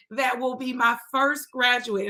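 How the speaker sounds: ordinary talk, nothing marked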